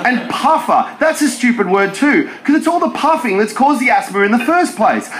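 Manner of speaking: ordinary delivery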